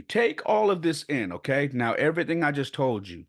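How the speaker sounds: plain reading voice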